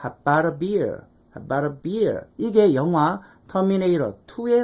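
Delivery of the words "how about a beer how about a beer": "How about a beer" as "habara beer"; learned native form. Shown as a demonstration. Teacher reviewing what was just learned, linked native form. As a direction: link "How about a" smoothly with a flapped t. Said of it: "방금 우리가 배웠던 거".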